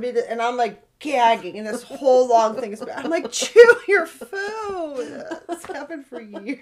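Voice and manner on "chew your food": loud, high, slow mock yell; exasperated scolding. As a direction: scold a kid through laughter. Retold exasperated parent at choking child.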